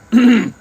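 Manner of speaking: sharp loud throat-clearing cough; choking cough. Real, not acted. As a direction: cough hard, choking on a drink. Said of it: "My God, I'm choking".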